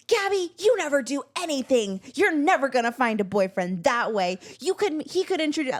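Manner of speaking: mock mother going in on her; imitating Mom. Mom scolding her daughter about boyfriends, high nagging. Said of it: "she literally was like".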